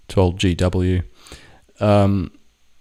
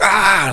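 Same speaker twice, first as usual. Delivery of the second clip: startled yelp; 12 semitones higher, 7 dB louder than usual. Retold or imitated. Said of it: "we just both were like".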